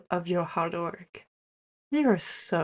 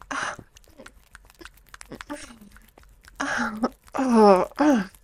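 loud moans; 5 semitones higher, 6 dB louder, much slower than usual. Acted. Peak moment of intimate roleplay.

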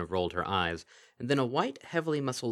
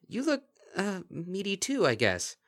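hesitant reply; 12 semitones higher, 3 dB louder than usual. Acted. Lyra returns compliment.

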